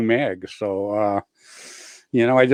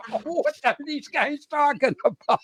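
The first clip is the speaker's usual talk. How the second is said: laughing bewilderment; 10 semitones higher, 7 dB quieter than usual. Retold or imitated. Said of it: "I'm like".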